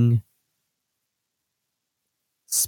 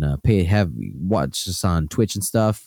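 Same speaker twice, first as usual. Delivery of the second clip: normal talking voice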